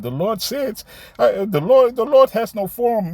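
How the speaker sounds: high, loud mocking imitation of a visitor; mimicking a camp visitor's claim, high and mocking. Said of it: "Somebody came, uh, last week to the camp".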